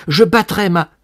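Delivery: loud, high mock jealous outburst; worked-up jealous boast. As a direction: build to a passionate threat, then break off. Would-be jealous lover works himself up. (emportement jaloux, fort et aigu)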